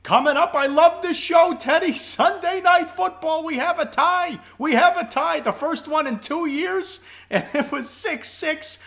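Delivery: high, loud, fired-up sports host; excited hype. Real, not acted. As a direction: gush over a wild game at full pitch. Host excitedly opens on tie game.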